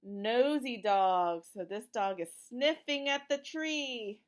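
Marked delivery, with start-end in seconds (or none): none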